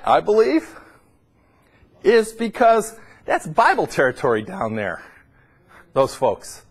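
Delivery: ordinary talk, nothing marked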